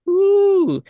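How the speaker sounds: high, drawn-out ooh of scandal; high knowing ooh; gossipy ooh. Real, not acted. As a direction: savour the gossip. Reacting to juicy gossip.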